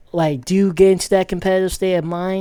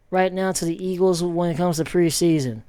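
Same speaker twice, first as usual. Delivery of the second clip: ordinary talk, nothing marked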